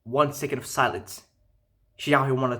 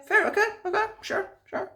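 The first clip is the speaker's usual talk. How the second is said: high, sarcastic concession; sarcastic, brushing it off; mock agreement. Guy sarcastically concedes, high mocking tone. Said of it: "mocking dismissive agreement".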